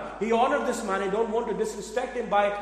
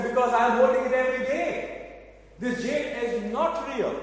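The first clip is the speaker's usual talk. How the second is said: excited insistence; 6 semitones higher than usual. Acted. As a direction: proudly insist he can tell now. Boy exclaims he knows.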